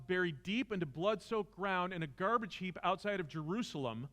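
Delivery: preaching at full force. Acted. Teacher preaching the war of ideas to students, fervent. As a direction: drive it home like a sermon.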